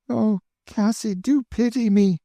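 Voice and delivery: put-on high, anxious girlish pleading; pleading young girl. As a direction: beg her to run away with you. Emmeline begs for pity.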